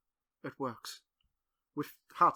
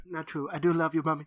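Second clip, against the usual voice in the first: normal talking voice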